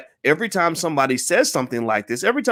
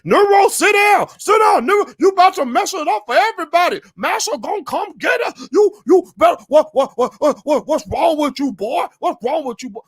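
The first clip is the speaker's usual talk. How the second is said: hammed-up scolding shout to hush him; screeching mock alarm, much higher; mock frantic shout; 11 semitones higher than usual.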